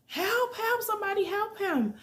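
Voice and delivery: imitated high scream, held back in volume; shrill-pitched mock scream of a frantic woman; screaming for help. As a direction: mimic her desperate cries for help. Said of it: "the girl is still screaming".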